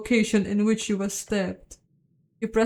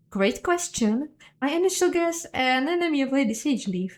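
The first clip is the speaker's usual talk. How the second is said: pitched up character voice; high NPC voice; 6 semitones higher than usual. Acted. NPC voiced in higher put-on voice.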